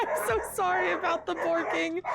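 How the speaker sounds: real flustered laughter; laughing apology. Real, not acted. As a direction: giggle out the apology. Laughing over barking chaos.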